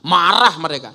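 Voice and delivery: sudden loud burst on the first word; emphatic outburst. Real, not acted. Speaker telling the crowd how offended they were, emphatic outburst.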